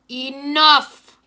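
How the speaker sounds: sudden loud bark of a command; shout. A narrator's reading. Klaus ends the brawl with a loud shout. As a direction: stop the fight with one loud shout. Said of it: "Klaus shouted".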